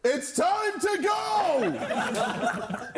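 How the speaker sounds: high, drawn-out laughing shout. Acted. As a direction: yell it out to end the call. Abruptly ending the call.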